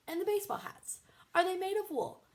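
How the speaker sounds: high, fretting mom voice; a hint of anxious mother; fussy mother. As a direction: worry aloud. Bitsy fretting.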